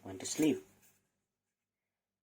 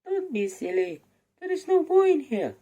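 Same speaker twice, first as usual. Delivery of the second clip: much higher, louder shrieking wife; scolding giant's wife; 9 dB louder than usual. Acted. The wife scolds the giant, high loud dismissive voice. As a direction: brush him off, high and loud.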